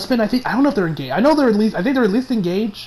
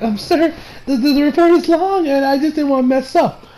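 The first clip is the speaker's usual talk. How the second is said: higher-pitched imitation of a flustered underling; nervous subordinate; 7 semitones higher than usual. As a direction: stammer out a high, apologetic excuse. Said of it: "She is slowly walking back, being like".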